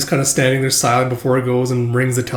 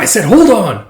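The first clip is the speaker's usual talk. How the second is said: mock insistence; 7 semitones higher, 7 dB louder than usual. Coworker insists they wait.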